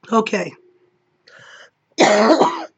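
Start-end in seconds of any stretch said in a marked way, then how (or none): 2.0-2.7 coughing fit. Real, not acted. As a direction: cough breaking into a greeting. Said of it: "coughing attack".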